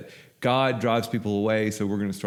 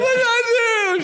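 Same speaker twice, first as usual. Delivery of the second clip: frightened; 8 dB louder, much faster than usual. Retold or imitated. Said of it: "she's just, like, shaking".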